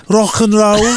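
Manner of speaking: not-Scouse rocker accent. Shown as a demonstration. Co-host defending his Oasis impression, mock Mancunian accent. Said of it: "That wasn't Scouse".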